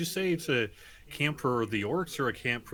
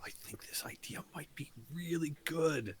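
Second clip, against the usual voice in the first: half-whispered, much softer than usual; hushed in-character pitch; 3 semitones higher, 9 dB quieter than usual. Acted. Player pitching an idea to Bori, hushed. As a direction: share the idea in a near whisper.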